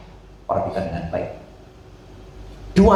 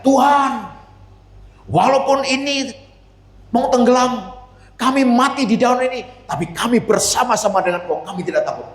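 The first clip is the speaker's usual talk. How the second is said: crying out; 11 semitones higher than usual. Pretend. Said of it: "Yesus ingin mendengar teriakan mereka".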